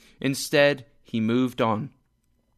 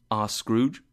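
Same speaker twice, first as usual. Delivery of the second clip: usual speaking voice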